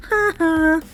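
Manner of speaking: high, loud sing-song mockery; gloating taunt. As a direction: rub it in, grinning at Derek.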